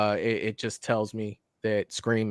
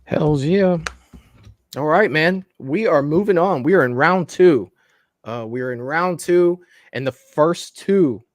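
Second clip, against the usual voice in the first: loud, pumped host announcing the round; announcer voice; 4 semitones higher, 5 dB louder than usual. Acted. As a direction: hype it up like a show host.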